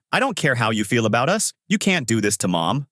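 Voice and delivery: ordinary delivery, nothing marked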